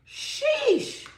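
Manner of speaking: a startled reaction to the cards; high pitched amazed sheesh; surprised sheesh. Real, not acted. Reacts to drawn tarot cards.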